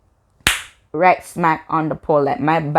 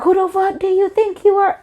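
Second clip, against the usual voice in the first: very high-pitched mocking imitation; mimicking the girl; 13 semitones higher than usual. Girl challenging the one breaking up the fight, high mock voice. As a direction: put on a squeaky mock voice for her challenge. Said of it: "She's like".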